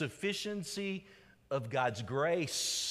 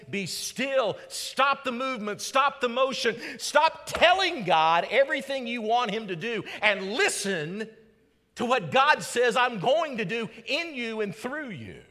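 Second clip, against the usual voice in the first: louder, higher scripture call; preached scripture; 8 semitones higher, 6 dB louder than usual. Real, not acted. Pastor quotes the psalm to the church, loud and high. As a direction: command them to be still. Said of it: "like Psalms thirty-seven seven says".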